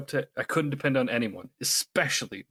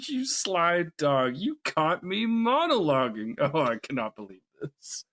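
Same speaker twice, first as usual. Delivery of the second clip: slow, high mocking villain drawl; theatrical villain gloat; 4 semitones higher than usual. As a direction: savor each word like a smug villain. Quoting Syndrome villain lines.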